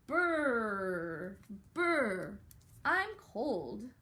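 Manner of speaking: loud shivery "brr, I'm cold"; shivering cold voice. Acted. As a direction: act freezing in the snow. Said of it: "have Miss McCracken's brother say".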